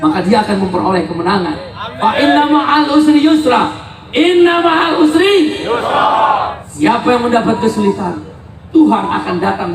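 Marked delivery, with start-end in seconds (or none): 1.8-6.1 Quran recitation. Acted. Quoting Quran verse at rally.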